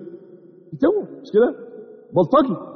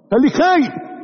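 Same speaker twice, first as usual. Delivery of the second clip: urgent plea; 8 semitones higher than usual. Acted. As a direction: press the warning home. Preacher urging fear.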